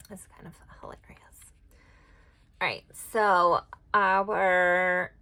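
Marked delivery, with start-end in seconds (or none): 0.1-1.5 soft amused whisper to herself; whispered aside. Real, not acted. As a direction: breathe it out like a private joke. Quiet amused aside at card.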